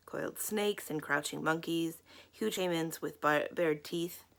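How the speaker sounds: ordinary delivery, nothing marked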